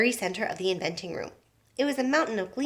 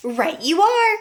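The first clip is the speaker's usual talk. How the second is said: high, loud hearty cry; cried out; 8 semitones higher, 8 dB louder, slower than usual. A narrator's reading. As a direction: boom it while slapping her on the back. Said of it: "cried Mister Wonka".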